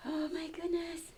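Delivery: usual speaking voice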